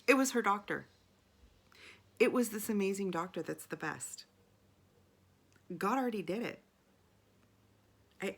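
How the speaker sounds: loud, thrilled realization; excited. Real, not acted. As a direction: burst out in amazed joy. Excited realization of God's plan.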